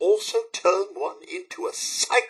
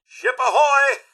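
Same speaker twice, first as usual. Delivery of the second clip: high, raised sailor's call; sailor's hail; 10 semitones higher, 4 dB louder than usual. Pretend. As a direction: call it out across the water. Calling out like a sailor.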